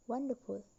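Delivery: wonderful with the stress kept on won; stress on one. Shown as a demonstration. Teacher showing a neutral suffix, wonderful stressed on won. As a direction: say wonderful, leaning on its first syllable. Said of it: "The stress remains on the one".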